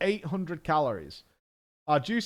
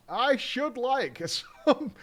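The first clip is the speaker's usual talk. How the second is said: laughing; 9 semitones higher, slower than usual. Real, not acted. Laughing mid-sentence.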